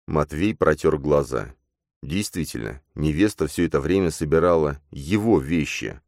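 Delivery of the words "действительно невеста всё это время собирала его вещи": ordinary delivery, nothing marked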